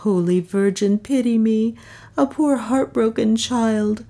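sorrowful, high-voiced appeal; heartbroken moan. A narrator's reading. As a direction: plead pitifully from a broken heart. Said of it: "murmured Beatrice with a low moan out of her heart".

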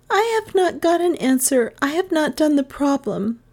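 put-on high little-girl confession; pupil confessing. Gentian stands before her schoolmates, confessing.

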